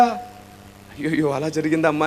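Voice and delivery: soft, slow sorrowful ayyo; sympathetic sigh. Retold or imitated. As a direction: voice a pastor sharing grief, mildly. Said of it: "బాధపడతాను".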